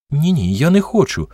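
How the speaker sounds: anxious-to-refuse "no, no"; hasty objection. A narrator's reading. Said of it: "поквапливо заперечила вона".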